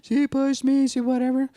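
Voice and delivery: put-on high, loud child's complaint; arguing grandchild. Grandkid complaining about being pushed, arguing child voice. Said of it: "if the grandkids are arguing".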